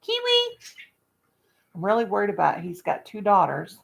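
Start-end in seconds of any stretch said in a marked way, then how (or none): 0.0-0.6 calling bird. Real, not acted.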